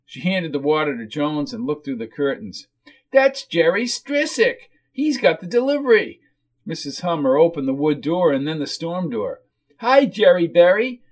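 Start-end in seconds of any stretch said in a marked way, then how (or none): 3.1-6.2 whiny character voice, pitched up; whiny hostess. Acted. 9.8-11.0 high, loud whiny hello; whiny woman's voice, pitched up; whiny hostess greeting. Acted.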